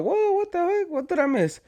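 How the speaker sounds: high-pitched, soft mock whoa; surprised reaction. Storyteller replaying his reaction to the girl approaching his homeboy, mock surprise. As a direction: react in a high, light voice of disbelief. Startled retold reaction to the scene.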